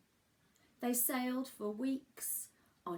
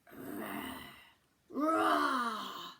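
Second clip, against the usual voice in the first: loud playful roar, rising to a shout; a hammed-up dinosaur call from the jungle; 3 semitones higher, 5 dB louder than usual. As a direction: roar like a big friendly dinosaur. Dinosaurs roaring from island.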